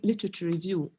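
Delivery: normal talking voice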